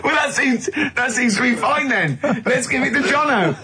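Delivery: laughing. Real, not acted. Laughing through ironic reply.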